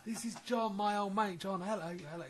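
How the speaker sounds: high, bright introduction; imitating Michelle. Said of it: "zombie junkie comes staggering".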